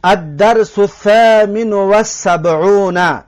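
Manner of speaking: slow, careful recitation of the Arabic title; slow Arabic reading. Shown as a demonstration. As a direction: read the Arabic title out slowly.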